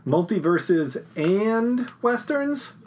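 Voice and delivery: slow, disbelieving question; incredulous. Real, not acted. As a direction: drag it out in disbelief.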